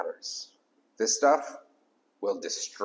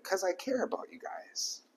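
soft whispered confession of care; sincere whisper; 9 semitones higher, 8 dB quieter than usual. Real, not acted. Pastor tells the congregation he cares, whispered. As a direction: whisper it like a secret. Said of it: "whispered sincerity".

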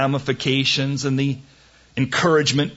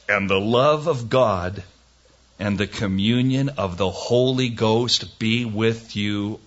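scripture reading; 3 semitones lower than usual. Acted. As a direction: read it like solemn scripture. Reads closing verses aloud.

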